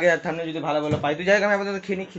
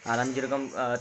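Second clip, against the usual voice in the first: ordinary talk, nothing marked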